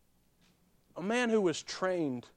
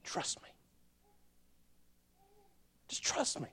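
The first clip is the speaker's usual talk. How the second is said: soft, breathy whispered voice of God; God's whisper; 4 semitones higher, 11 dB quieter than usual. Acted. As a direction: whisper it gently, like God speaking in the dark. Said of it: "and God just said".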